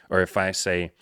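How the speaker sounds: ordinary talk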